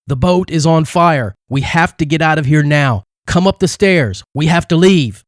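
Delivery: high, urgent yelling; yelling a warning. A narrator's reading. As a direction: warn the terrified family to run. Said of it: "began yelling at the terrified".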